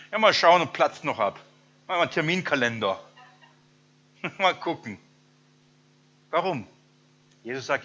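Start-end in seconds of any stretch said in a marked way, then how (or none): 0.1-5.0 loud, haughty brush-off, checking the calendar; arrogant. Retold or imitated. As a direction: put the proud ones on show, off-hand. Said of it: "die stolzen, arroganten". (überheblich und laut, von oben herab)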